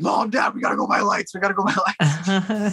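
put-on high-pitched, frantic kid pleading; mock panicked urgency. Young director rushing home to his parents, comically frantic.

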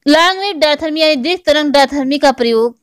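usual speaking voice

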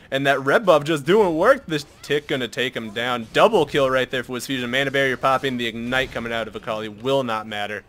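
ordinary delivery

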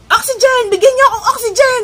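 very high, loud desperate yell; shouting for oxygen. Retold or imitated. To the clinic staff, a loud desperate demand. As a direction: scream for oxygen like you can't breathe. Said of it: "Sigaw-sigaw pa ako".

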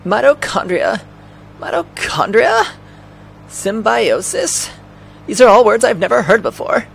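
high, puzzled woman's voice; Aya. Acted. Aya puzzling over words she never heard, high-pitched.

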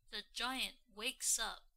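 ordinary delivery, nothing marked

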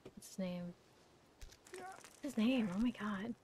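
soft mutter under the breath; muttering to self. Real, not acted. Racking his brain for a character name, muttering. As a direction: ask yourself quietly, searching memory.

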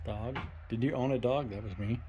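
normal talking voice

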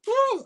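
a sudden high yelp covering the tears; sudden whoop. Retold or imitated. Holding back tears in the cinema, a sudden high whoop. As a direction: burst out a high whoop instead of crying. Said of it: "I went".

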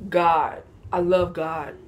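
usual speaking voice

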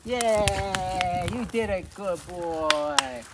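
loud, happy praise; excited praise. Real, not acted. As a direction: cheer the dog on. Cheering the dog.

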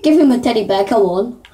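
ordinary talk, nothing marked

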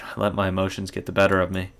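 normal talking voice

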